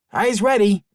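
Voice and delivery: put-on high, loud young boy; a small boy calling out eagerly; child voice. Boy character giving an order.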